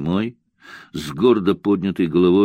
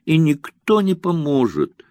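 high tearful outcry; acted weeping cry; 9 semitones higher than usual. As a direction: despair spilling over in tears. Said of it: "громко заплакала Муся".

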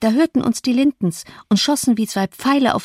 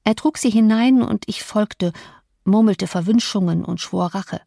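ordinary delivery

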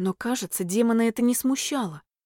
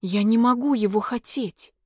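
usual speaking voice

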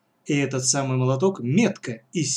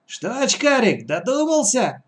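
put-on old woman; 8 semitones higher than usual. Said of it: "спросила старуха".

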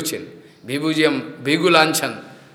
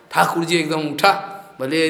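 usual speaking voice

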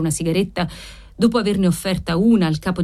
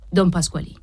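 ordinary delivery

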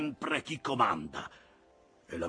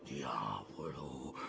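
low, quiet and drawn out; menacing growl; 6 semitones lower, 8 dB quieter, much slower than usual. A narrator's reading. Ahab reacts to his officer's criticism, low slow menace. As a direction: seethe on one long word.

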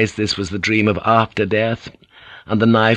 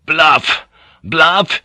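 higher, louder spat Bluff; furious outburst; 7 semitones higher, 5 dB louder than usual. A narrator's reading. Yabu rages over the bluff in front of his maids, loud and high. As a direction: fume at the word.

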